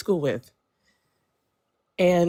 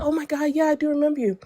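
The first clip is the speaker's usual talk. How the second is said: much higher, eager remembering; retold excited recognition; 10 semitones higher, much faster than usual. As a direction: voice her surprised delight, pitched up. Retold friend remembering her.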